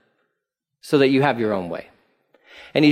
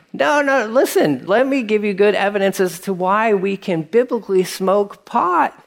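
much higher, insistent mock buddy voice; Rastafarian buddy; 5 semitones higher than usual. Rastafarian buddies persuading the newly saved preacher, higher and insistent. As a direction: imitate an eager friend making his case. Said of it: "my Rastafarian buddies".

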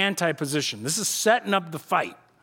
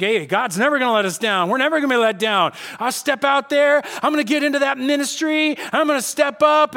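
building high, loud enthusiasm; eager confidence; 8 semitones higher, 4 dB louder than usual. Acted. Believer sure God won't let them down, eager and rising. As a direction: build higher with hammed-up zeal.

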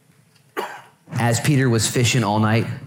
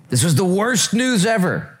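despairing disciples; 11 semitones higher than usual. Acted.

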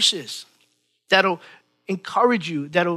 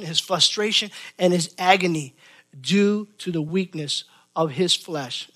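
usual speaking voice

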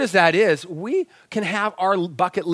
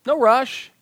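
reluctant plea; 8 semitones higher, slower than usual. Retold or imitated. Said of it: "we feel more like".

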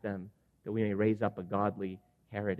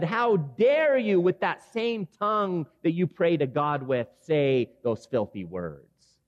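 a hint of a stern teacher scolding, higher, louder and slow at first; preacher-style rebuke; 5 semitones higher, 4 dB louder, slower than usual. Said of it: "like, preacher posture".

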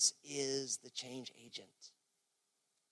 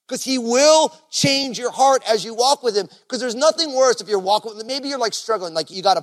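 fervent preaching; 7 semitones higher, 7 dB louder than usual. Acted. Preaching at full force.

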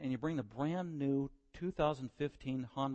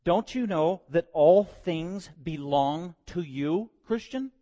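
slow, louder, rising preacher question; preaching; 3 semitones higher, 8 dB louder, much slower than usual. Acted. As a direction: press the verse home to the congregation. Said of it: "Verse twenty-one".

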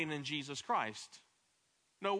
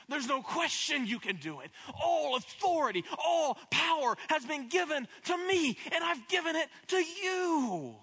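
preached shout; 7 semitones higher than usual. Acted. Jesus rallying his hesitant disciples, shouted preaching. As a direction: lift them up with a ringing shout.

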